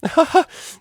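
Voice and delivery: high, loud helpless laughing; hysterical laughter. Acted. Octavia being tickled by Vinyl, hysterical laughter. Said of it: "hysterical laughter".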